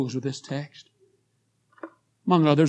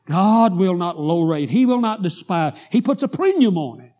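loud, raised-pitch preaching; preaching at full force; 3 semitones higher, 8 dB louder than usual. Acted. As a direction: drive the text home to the congregation. Preaches on contrite heart.

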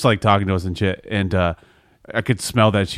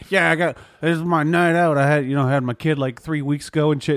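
put-on higher-pitched Upper Peninsula drunk lady accent; drunk Yooper accent; 6 semitones higher than usual. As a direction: play a tipsy Yooper mom in a U.P. accent, pitched up. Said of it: "I did that accent for the U P".

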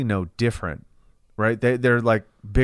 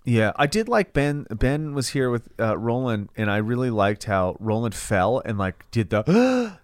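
ordinary delivery

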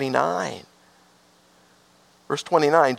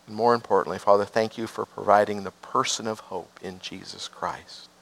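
prayer; 6 semitones lower than usual. Acted. Pastor leading the congregation in a hushed closing prayer.

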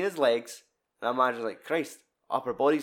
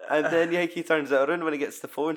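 ordinary talk

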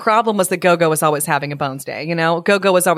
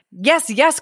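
higher-pitched eager dog character; excited cry; 8 semitones higher than usual. A narrator's reading. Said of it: "cried Samanbusa".

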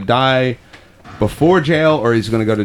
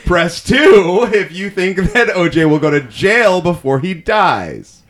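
higher, slow game-show voting announcement; raised, drawn-out mock announcer; mock phone-in vote host; 6 semitones higher, slower than usual. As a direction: sell the second option like a TV vote host.

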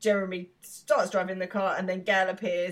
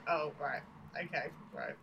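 quiet deflated voice; 4 semitones lower, 11 dB quieter than usual. Retold or imitated. Sophie's deflated reply.

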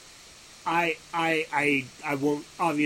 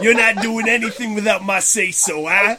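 loud, bossy put-on voice; mock angry haggler; 4 semitones higher, 8 dB louder than usual. Imitating co-host bossing the artist around, loud mock anger. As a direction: bark it like a domineering agent. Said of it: "That could be my angry Raiden voice".

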